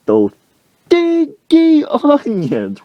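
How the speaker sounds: very high, stretched-out holler. Retold or imitated. Coach before other customers, high mock holler. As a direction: holler it slowly, voice pushed way up. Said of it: "he's just hollering".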